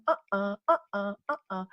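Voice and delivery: normal talking voice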